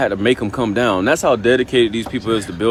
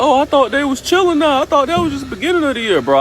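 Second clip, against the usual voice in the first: mock naive friend, much higher and fast; clueless friend; 14 semitones higher than usual. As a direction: toss it off like a clueless buddy in a squeaky put-on voice. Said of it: "he was like".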